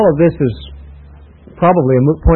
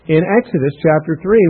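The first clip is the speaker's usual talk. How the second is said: ordinary talk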